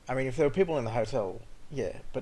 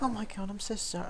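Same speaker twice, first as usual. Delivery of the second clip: much higher, put-on apologetic voice; apologetic driver; 9 semitones higher, 4 dB quieter than usual. Driver apologising after rear-ending him, high put-on voice. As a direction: gush an apology. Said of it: "he's like".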